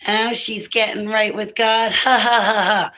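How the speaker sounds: funny jokester voice. Retold or imitated. Cop laughing at her from behind a flashlight, mocking jokester voice. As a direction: imitate a jeering cop, tacking on a mock ha-ha. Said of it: "in a funny jokester voice".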